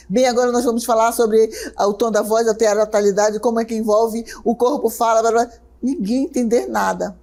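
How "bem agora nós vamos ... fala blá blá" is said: hurried teacher racing into babble; rapid rushed lecture. Shown as a demonstration. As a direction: rush through it without a breath. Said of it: "eu sei que eu falo um pouco rápido".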